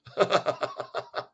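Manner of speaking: a quick airy laugh; breathy laugh. Real, not acted. Watching her face, a quick breathless laugh. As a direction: laugh under your breath.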